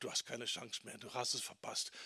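lightly voiced devil, low and hushed; devil's voice. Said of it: "Und er sagt". (leise, tiefer Teufel als Ankläger)

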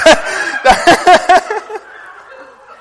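loud high laughter. Real, not acted. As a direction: laugh hard. Laughing after mock excuse.

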